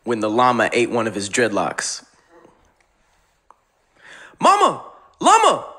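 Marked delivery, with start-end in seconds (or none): 4.4-5.7 shouting the punchline high; yelled. Acted. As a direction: yell it out loud. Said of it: "yell out".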